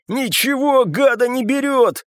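high, strained cry; wheezed out. A narrator's reading. Папаша failing to bring Славик down, wheezing. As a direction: force it out hoarsely in frustration. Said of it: "прохрипел папаша".

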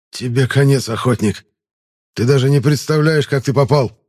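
lightly voiced wounded wheeze; wheezing. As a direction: rasp out the threat, hurt. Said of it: "прохрипел раненый".

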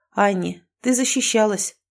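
ordinary delivery, nothing marked

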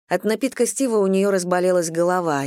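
usual speaking voice

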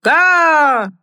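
high loud clown shout; shouting over roar. Acted. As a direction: yell over the noise. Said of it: "крикнул он, стараясь перекричать рёв".

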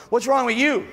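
the negative person snaps at the hopeful one; mock scolding. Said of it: "somebody shows up and goes".

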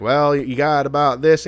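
put-on slow, old-timey western voice. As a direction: drawl it like an 1800s cowboy. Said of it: "those eighteen hundred westerns".

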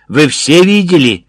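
loud, high-pitched demand; shrieking. A narrator's reading. Major appealing to witnesses of the attack, shrieking. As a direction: raise pitch and volume, rallying onlookers. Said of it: "верещав Краснов Триколору".

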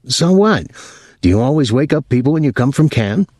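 high-pitched, annoyed comeback; irritated retort. A narrator's reading. Thomas to drunk Pinky who woke him, irritated. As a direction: throw it back, annoyed, pitch jumping up.

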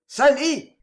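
a high, loud call over the din; one voice yelling above the others; shouted name. Acted. A customer shouting for Sally over the crowd. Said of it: "cria une voix dominant les autres".

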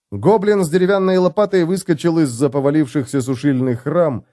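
usual speaking voice

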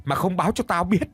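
high-pitched angry demand; heated, outraged questioning; roaring accusation. A narrator's reading. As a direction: tear into your brother for an explanation. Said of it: "Lão Tâm thì gầm lên".

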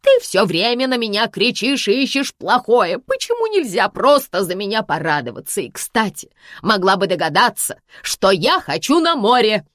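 high, loud aggrieved complaint; a hurt mother demanding sympathy; mother whining at her daughter; offended mother. Acted. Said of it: "обиделась мама".

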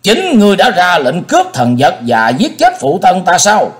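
loud bark. Acted. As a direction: bellow the accusation. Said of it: "quát lớn". (quát lớn)

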